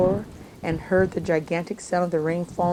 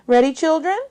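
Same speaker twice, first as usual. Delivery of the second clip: teacher voice; 9 semitones higher, 5 dB louder than usual. Acted. Teacher addressing class.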